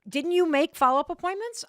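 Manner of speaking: high, loud incredulous question; husband quizzing wife about vet appointments, incredulous; husband's pointed question. Retold or imitated. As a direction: throw it at her in disbelief. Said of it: "he's like".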